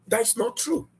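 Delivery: ordinary talk, nothing marked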